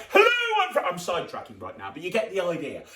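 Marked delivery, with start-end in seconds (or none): 0.1-0.9 much higher, loud boastful greeting; hammed-up showman. Shown as a demonstration. Magician showing kids how to introduce themselves, high and loud. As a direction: puff yourself up as Bob. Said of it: "it'd be like".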